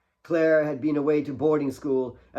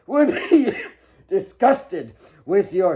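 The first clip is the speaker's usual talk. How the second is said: loud, slowed words breaking into coughs; sick father choking out the rebuke; coughing through words; 6 semitones higher, 8 dB louder than usual. Acted. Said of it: "racked with a coughing fit".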